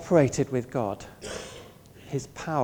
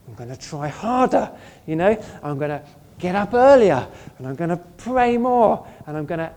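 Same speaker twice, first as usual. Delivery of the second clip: rising, louder self-pep talk; building eager vow, louder and higher toward "pray more"; mock determined resolve; 3 semitones higher, 8 dB louder than usual. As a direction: pump yourself up with empty promises. Mocking the resolve to try harder.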